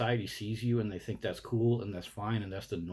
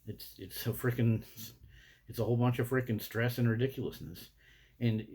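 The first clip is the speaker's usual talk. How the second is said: ordinary delivery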